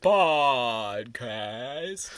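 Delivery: drawn-out British-tinged intro word. Pretend. Co-host finishing the podcast intro, drawn-out British voice. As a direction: stretch it out slowly with a British lilt. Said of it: "say it in a British voice".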